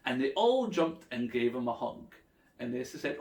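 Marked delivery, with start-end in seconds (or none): none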